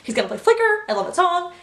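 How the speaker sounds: high, thrilled gasp rising into a squeal; hushed gasp, then a high excited burst; excited fangirl squeal. Retold or imitated. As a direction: act out the giddy moment he names the song. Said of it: "And I was like".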